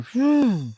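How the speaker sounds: long high "phew" swooping up and down; imitated whoosh. Reviewer voicing Sonic in a panel, high imitated whoosh. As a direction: mimic something zipping past.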